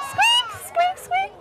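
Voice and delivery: very high-pitched little squeaks; mouse squeaking. Shown as a demonstration. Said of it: "say it in mouse".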